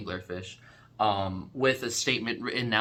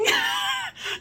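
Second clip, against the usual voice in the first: high shrieking laugh. Real, not acted. Laughing after terrified shriek.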